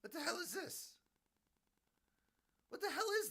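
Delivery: high, baffled disbelief; a bewildered old guy staring at the screen; baffled old man. Retold or imitated. Old man watching his past life, high baffled disbelief. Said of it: "and he's sitting there going".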